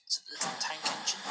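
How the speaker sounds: ordinary delivery, nothing marked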